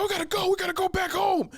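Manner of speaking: high-pitched shouted imitation of worked-up friend; frantic insistence.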